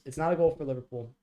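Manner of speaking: fast, loud protest; outraged disbelief. Real, not acted. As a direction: insist it can't count, fast and loud.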